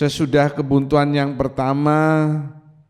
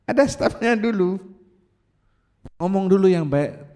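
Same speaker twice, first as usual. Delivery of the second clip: ordinary delivery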